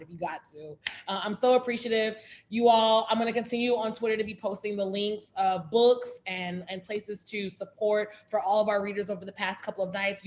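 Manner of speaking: usual speaking voice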